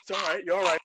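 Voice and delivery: high, insistent mock Australian soothing; Steve Irwin. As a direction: repeat it reassuringly in a hammed-up Aussie accent. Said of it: "Steve Irwin voice".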